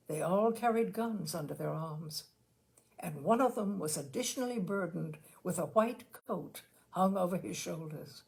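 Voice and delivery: plain reading voice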